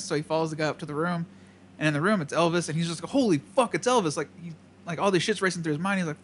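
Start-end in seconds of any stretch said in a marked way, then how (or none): 3.1-4.2 starstruck exclaim. Retold or imitated. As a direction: gush like a starstruck fan. Retold awe at meeting [name].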